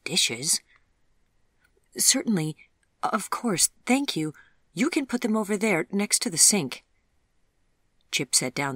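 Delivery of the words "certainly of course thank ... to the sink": put-on higher, flustered young woman; a lightly voiced Vivian, caught off guard and polite; Vivian's voice. A narrator's reading. Vivian, surprised, directs Chip to put the dishes by the sink.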